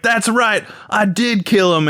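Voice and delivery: high, lightly hammed villain boast; Tex Conway. Texan spy admits the killing, mock-proud voice.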